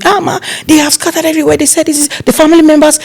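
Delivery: high, fast alarmed report; panicked staff call. Retold or imitated. A staff girl calling the planner in alarm, high and fast. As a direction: rush it out, high and urgent. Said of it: "started calling me".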